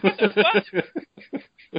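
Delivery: very slow, drawn out through a laugh; laughing shock. Retold or imitated. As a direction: stretch it out, half laughing in mock shock. Laughing retold shock at the game.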